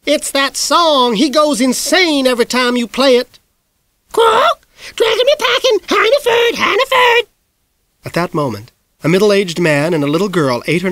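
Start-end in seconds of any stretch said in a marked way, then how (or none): 0.1-3.4 high, loud sisterly complaint; Miss Greenglass voice. A narrator's reading. Miss Greenglass calling from the hall, exasperated. 4.1-7.3 shrieking parrot squawk, very high and loud; parrot screech. A narrator's reading. The parrot screeching at the piano, a shrill squawk.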